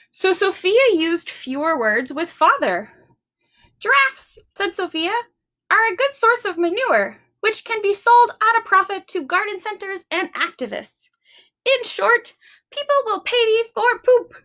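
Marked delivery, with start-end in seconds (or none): 3.8-14.3 put-on lightly raised, eager little-girl voice; a hint of a keen kid making her case; girl's eager pitch. Sophia pitching giraffes to Father, lightly raised and eager.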